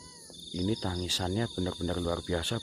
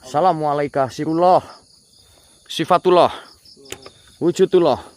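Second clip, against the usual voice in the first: calling out to spirits; 5 semitones higher, 11 dB louder than usual. Real, not acted. Ghost hunter summons the unseen spirits, loud and solemn. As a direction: call into the dark as if to be heard by spirits.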